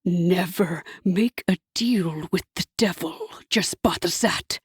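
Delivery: a devil's warning; lightly low devil. A narrator's reading. Hasatan's voice.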